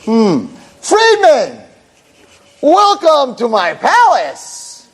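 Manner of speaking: high, drawn-out mock-regal greeting; grand welcome. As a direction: welcome him like a lord, slow and high. Cheerful host greets new roommate.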